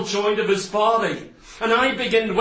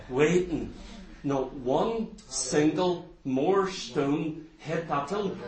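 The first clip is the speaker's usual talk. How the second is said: low, soft suspense; hushed storytelling; 8 semitones lower, 10 dB quieter than usual. Retold or imitated. Preacher recounting how the stones stopped, hushed storytelling. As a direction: hold the congregation in a low hush. Hushed retelling after the quiet prayer.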